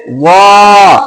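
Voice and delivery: "वा" thick, with a long held rounded vowel; drawn-out वा. Shown as a demonstration. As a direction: stretch out "वा". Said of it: "खींच के पढ़ना है". (खींच के लंबा "वा")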